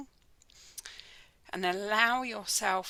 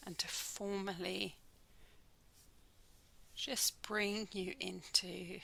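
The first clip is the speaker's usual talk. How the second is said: measured, calm delivery, letting each phrase settle; guided meditation; 5 dB quieter than usual. Acted. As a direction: guide listeners gently into the body scan, unhurried. Leads a guided body scan meditation.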